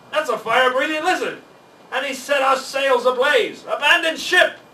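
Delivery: loud, high panicked alarm; panicked shout. A narrator's reading. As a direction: yell it as the sails catch fire. Ship on fire, panic.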